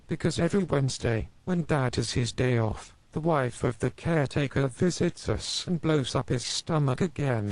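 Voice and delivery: put-on high child voice; smiling little boy. A narrator's reading. Max answering his mother, high and innocent. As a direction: tell it brightly like an innocent kid. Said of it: "Little Max smiled and answered".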